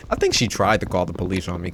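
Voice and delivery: usual speaking voice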